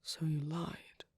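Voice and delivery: slow, hushed realisation of a lie; drawn-out, breath-laden statement. Acted. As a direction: let it land slowly, half under the breath. Hurt reaction to being lied to.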